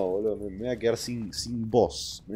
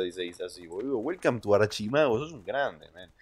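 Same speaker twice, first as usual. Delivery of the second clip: usual speaking voice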